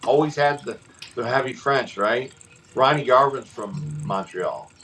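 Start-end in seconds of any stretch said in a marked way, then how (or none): none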